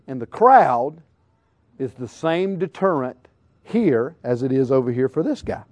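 normal talking voice

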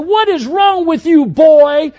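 hammed-up angry sports dad; mock shouting dad. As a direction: yell it from the stands like an obnoxious father. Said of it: "Then he shouted".

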